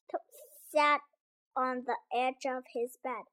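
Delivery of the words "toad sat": plain reading voice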